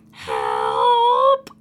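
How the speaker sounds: high howled help, rising like a dog's whine; a dog's drawn-out, high yelp shaped like "help"; funny dog noise. Retold or imitated. Mom imitating her dog stuck on his back, funny dog noise. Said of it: "made this funny dog noise that".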